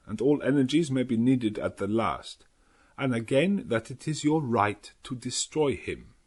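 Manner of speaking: put-on earnest old Dutch scholar laying out the plan; Van Helsing's Dutch accent. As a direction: Dutch professor voice.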